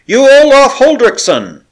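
loud high shout across the water. A narrator's reading. Captain hailing a ship, loud shout. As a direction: hail him at full voice. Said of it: "shouted Da Costa".

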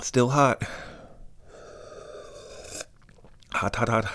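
sipping hot tea while talking; mouth full of hot tea. Real, not acted. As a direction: talk around a sip of hot tea. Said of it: "let's test this tea out".

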